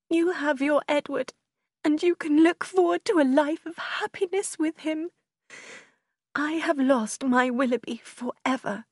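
put-on high, distraught young heroine; heartbroken sister. A narrator's reading. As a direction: lament a lost love in a high voice.

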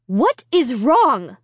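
a panicked mother demanding answers; mother screaming. A narrator's reading. Mother screaming at the boy by her daughter. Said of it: "Alicia's mother was screaming".

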